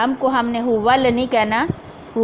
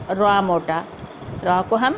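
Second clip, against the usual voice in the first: usual speaking voice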